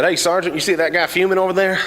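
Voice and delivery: hillbilly soldier. Retold or imitated. Cletus pointing out an angry guy to his sergeant, high and eager. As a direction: call out to the sergeant like a country boy.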